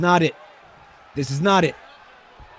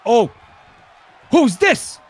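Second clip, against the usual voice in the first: high, sharp shouted question; startled; 4 semitones higher, 4 dB louder, slower than usual. Pretend. Commentator spotting someone entering the match, startled. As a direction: blurt it out at a surprise arrival. Commentator sees a surprise entrant.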